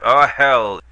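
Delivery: lightly acted bartender's impatient cut-off; impatient interruption. A narrator's reading. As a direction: snap over her words. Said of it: "interrupted Pete, anticipating".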